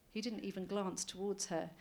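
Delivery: usual speaking voice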